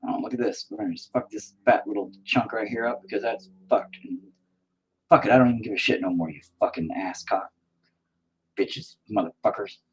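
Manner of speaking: ordinary talk, nothing marked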